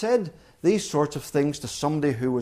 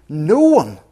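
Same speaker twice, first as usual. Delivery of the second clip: emphatic no one; 10 dB louder than usual. Acted. Preacher hammering the point.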